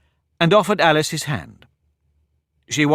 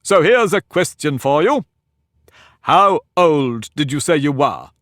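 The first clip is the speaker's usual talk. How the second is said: put-on pompous egg lecturing a girl; haughty egg; 3 semitones higher, 6 dB louder than usual. Humpty quizzing Alice.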